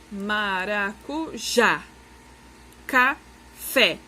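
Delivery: teacher demonstrating oxytone stress on "maracujá" and "café"; final syllable stressed. Shown as a demonstration. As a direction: lean hard on the last syllable of "maracujá" and "café". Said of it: "vai ser a última sílaba mais forte".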